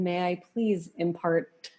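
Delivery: ordinary talk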